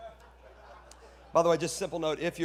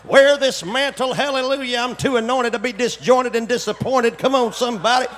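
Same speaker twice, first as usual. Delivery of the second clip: changed preaching voice; 12 dB louder than usual. Acted. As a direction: parody a preacher working up to full force. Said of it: "you get up to preach, you're like".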